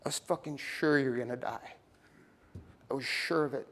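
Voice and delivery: a touch of choked-up relief, lightly acted; mildly tearful. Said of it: "And she burst into tears".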